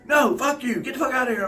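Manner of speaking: high, loud retold yell; hammed-up angry shout; mock furious clerk. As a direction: scream them out of the store.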